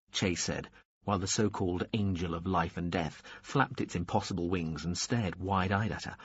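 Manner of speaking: usual speaking voice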